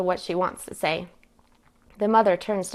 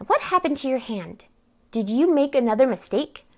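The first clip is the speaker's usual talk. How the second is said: higher-pitched, accusing questions; scolding; 6 semitones higher, 4 dB louder than usual. Acted. Aunt questions niece about her hurt hand, scolding. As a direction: press her, louder on the second question.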